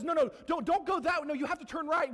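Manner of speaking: God pleading. Retold or imitated. Preacher voicing God pleading with us, high and loud. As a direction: plead loudly, high and urgent. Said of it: "God says".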